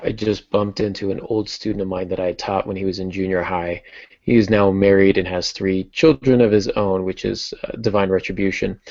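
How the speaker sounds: normal talking voice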